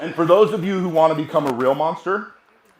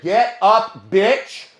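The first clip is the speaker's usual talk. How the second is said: louder, punched-out order; retold taunting command; 3 semitones higher, 5 dB louder, much slower than usual. Fighter standing over downed man, re-enacted angry taunt.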